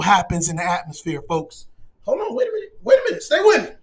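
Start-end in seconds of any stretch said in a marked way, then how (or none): 2.0-3.8 high-pitched interruption; sudden high, comic hold-up; halting the crowd. Acted. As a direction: pipe up to stop everything. Breaks off to halt the audience.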